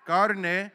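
"Carne" with a rolled R, Spanish style. Shown as a demonstration. Pastor shows the congregation how to say "Carne", rolled R. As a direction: roll the R in "Carne" a little. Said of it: "you gotta roll the R a little bit".

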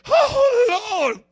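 loud, high swooning cry; mock starstruck swoon. Preacher mocks fans swooning over a star, hammed-up high wail.

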